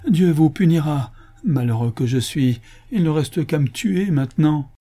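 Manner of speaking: high, loud curse turning to lament; desperate cry. A narrator's reading. Ruined Absalom cursing the commissioner and himself, desperate. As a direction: cry out in despair. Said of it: "poussa un cri désespéré".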